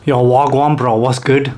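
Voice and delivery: put-on casual London roadman accent. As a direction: greet your mate in London roadman slang. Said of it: "it goes a bit like this".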